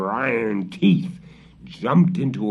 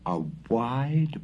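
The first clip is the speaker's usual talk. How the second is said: ordinary delivery, nothing marked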